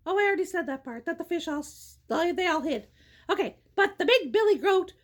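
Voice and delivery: plain reading voice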